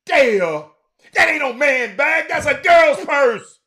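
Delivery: loud, high, slow, drawn out; slow, loud, high comic protest; 1 exasperated yell. Real, not acted. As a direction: stretch it out in loud comic disgust. Mock outrage at purse.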